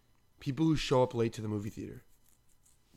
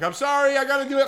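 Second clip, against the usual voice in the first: much higher, sheepish apology; high apologetic voice; 11 semitones higher, 8 dB louder, faster than usual. Retold or imitated. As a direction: squeak out a sorry. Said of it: "I'm like".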